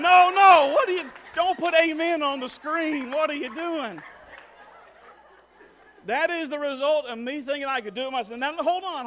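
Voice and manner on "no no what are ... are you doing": high, shouted objection; sudden loud, comic outburst of protest. Real, not acted. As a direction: break off and yell at the screen operator. Objects to picture on screen.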